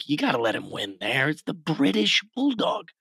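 a light mock British accent; playful half-hearted British accent. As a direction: toss off the line in a hint of British. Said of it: "Do you have a good British accent you can give us?".

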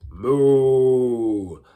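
loud, long-drawn moo; cow moo. Shown as a demonstration. Animal warm-up for the class, loud cow moo. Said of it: "Let's try a cow".

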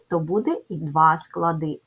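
normal talking voice

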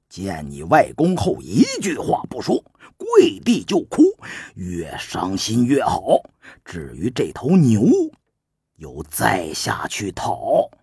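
Su Qin's voice. Acted. Su Qin instructing.

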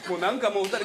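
ordinary delivery

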